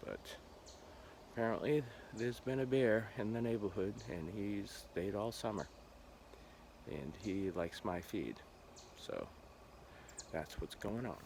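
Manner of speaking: usual speaking voice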